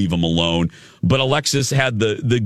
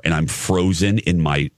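usual speaking voice

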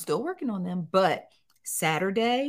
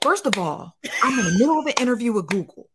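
clapping back, higher and fast; sassy lecture to her daughter; mock scolding; 5 semitones higher than usual. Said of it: "so I'm clapping".

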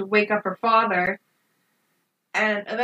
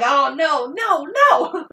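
dread at the screen; 6 semitones higher, 4 dB louder, slower than usual. Retold or imitated. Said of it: "And I'm like".

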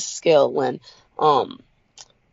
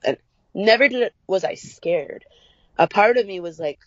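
normal talking voice

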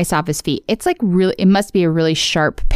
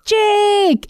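yelling a name; 14 semitones higher than usual. Retold or imitated. Finn yells for Jake.

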